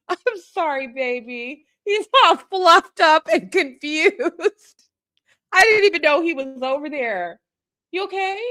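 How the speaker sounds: high cooing voice comforting a dog. Real, not acted. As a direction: coo at the ruffled dog. Soothing her startled dog.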